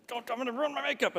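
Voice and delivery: high, loud mock wife voice; wife's tearful protest. Wife at the altar begging husband to stop, high mock squeal. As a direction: squeal it like the bride fussing over makeup. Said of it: "And Beth's like".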